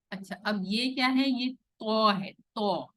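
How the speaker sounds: usual speaking voice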